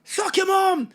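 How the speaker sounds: high, loud jeering yell; heckling shout. Retold or imitated. Rapper quoting the response he got, high shouted heckle. Quotes the crowd's hostile response.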